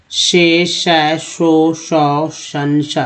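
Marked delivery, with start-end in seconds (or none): none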